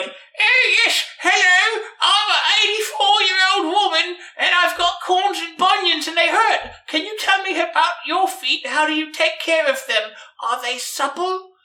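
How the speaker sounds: man doing a woman's voice; old lady voice. Retold or imitated. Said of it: "He did a voice like he's an old lady".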